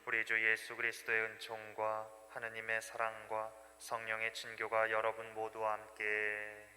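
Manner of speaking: liturgical intoning. Real, not acted. Priest opens the mass.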